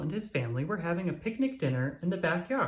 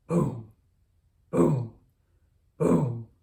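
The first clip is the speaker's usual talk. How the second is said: loud booming fireworks; 7 dB louder, much slower than usual. A narrator's reading. Narrated firework noise.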